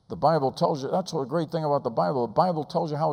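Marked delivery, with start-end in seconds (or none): none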